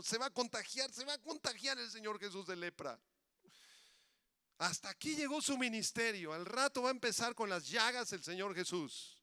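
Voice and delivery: high-pitched mock panic; worked-up, alarmed exclaiming; disciples' alarm. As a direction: fret aloud as a shocked onlooker.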